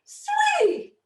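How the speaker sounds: very high, loud camp frog voice; frog character voice. Acted. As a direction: gush like a fabulous frog. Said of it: "So this is fabulously fabulous".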